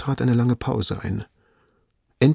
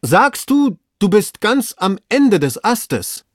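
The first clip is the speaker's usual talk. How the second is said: very high, loud, slow question; almost scream it in excitement; urgent raised cry; nearly screaming; 11 semitones higher, 5 dB louder, slower than usual. A narrator's reading. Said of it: "Jetzt schrie Legrand beinahe".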